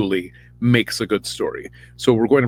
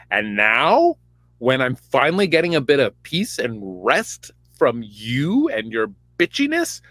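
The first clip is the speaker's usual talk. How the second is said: sassy Samuel; 5 semitones higher than usual. Retold or imitated. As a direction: read him with diva attitude. Samuel sassily rebuking Saul, voiced.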